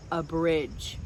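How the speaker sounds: usual speaking voice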